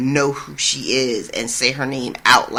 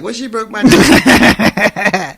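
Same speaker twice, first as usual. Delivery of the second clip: slow, higher, louder put-on gripe; grumbling Lem; 6 semitones higher, 6 dB louder, much slower than usual. Lem complaining to the room about Arya, slow, high and loud. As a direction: drag out the indignant complaint.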